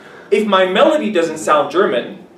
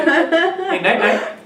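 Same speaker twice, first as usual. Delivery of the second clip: high, sing-song "night, night" with a laugh; native bedtime farewell; 12 semitones higher, much slower than usual. Shown as a demonstration. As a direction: lilt "night, night" up playfully. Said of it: "That's native".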